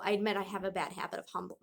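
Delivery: plain reading voice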